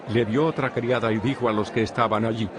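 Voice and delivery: usual speaking voice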